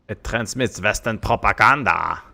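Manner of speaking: Russian radio announcer, hammed up; Russian accent. Streamer doing his best Russian for chat, mock Russian accent. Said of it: "It was my best Russian".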